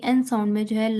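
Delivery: ordinary delivery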